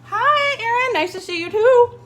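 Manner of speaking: bright, high, loud hello; cheery high greeting. Real, not acted. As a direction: greet a friend with delight. Greeting a live viewer.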